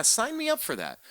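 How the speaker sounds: high mocking sign me up; sarcastic sneer, high and loud. Real, not acted. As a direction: scoff it, pitch jumping up.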